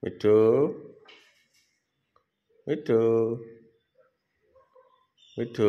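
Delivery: loud call to the parrot, each name on its own. Real, not acted. To the pet parrot so it will speak, a loud call.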